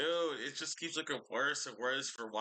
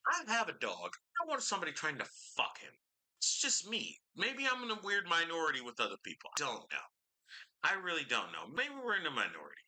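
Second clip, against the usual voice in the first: pitched high in disbelief; exasperated protest; 7 semitones higher than usual. Real, not acted. Exasperated sarcastic plea over video.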